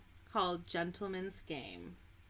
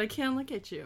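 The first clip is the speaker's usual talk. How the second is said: ordinary delivery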